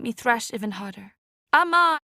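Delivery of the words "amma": loud, high desperate cry; calling for mother. Acted. Drowning girl calls her mother, loud desperate cry. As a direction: shout for Amma while choking. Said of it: "I tried to cry".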